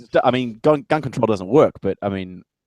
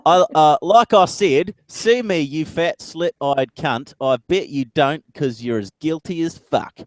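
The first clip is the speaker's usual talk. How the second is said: raised, mock-aggressive Aussie; higher-pitched Aussie insult impression; hammed-up Australian accent; 6 semitones higher, 4 dB louder, slower than usual. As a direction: rant it in a broad Australian accent. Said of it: "That's some quality rhetoric right there".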